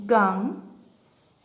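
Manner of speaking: "Ganguly" slowly, broken into three syllables with pauses. Shown as a demonstration. Teacher breaking up own surname to count syllables, syllable by syllable. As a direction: isolate each syllable of "Ganguly". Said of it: "এবার এটাকে ভাঙি".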